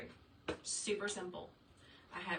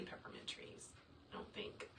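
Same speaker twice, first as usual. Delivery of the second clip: unsure whispered aside; muttered half-whisper; whispered afterthought; 10 dB quieter than usual. Real, not acted. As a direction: whisper the doubt to yourself.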